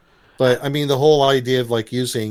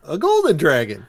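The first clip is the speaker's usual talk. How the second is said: quoted punchline; 5 semitones higher, 6 dB louder than usual. Retold or imitated. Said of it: "He's like".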